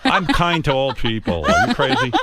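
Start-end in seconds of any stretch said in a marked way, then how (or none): 1.4-2.1 louder, higher, laughing are you crazy; laughing protest. Real, not acted. Host laughs off accusation, loud laughing protest.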